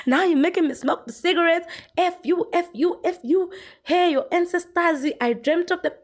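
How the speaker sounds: hammed-up stressed cursing; upset YouTuber. Vlogger mimicking a YouTuber cursing at critics. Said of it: "She's like".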